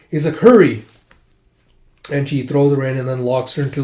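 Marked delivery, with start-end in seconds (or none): 0.4-0.8 loud, raised urgent cry; sharp rise in volume; urgent shout. Acted. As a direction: shout it to rush them along. Urging someone into hiding.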